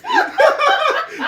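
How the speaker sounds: loud high cackle; helpless shrieking laugh; high cackling laugh. Real, not acted. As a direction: burst out cackling. Big laugh.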